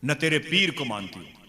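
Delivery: angrily disown his master; acted wifely outburst; scolding wife. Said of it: "बीवी ने बहुत डांटा है".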